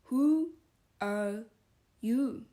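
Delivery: each word spaced out, loud and scornful; the caterpillar sneering at Alice, spaced and loud; contemptuous caterpillar. Acted. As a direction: look down on her, one word at a time. Caterpillar demands.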